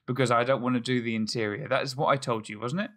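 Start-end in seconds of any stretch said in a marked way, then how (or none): none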